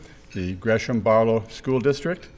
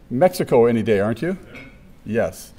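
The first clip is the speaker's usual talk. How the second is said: usual speaking voice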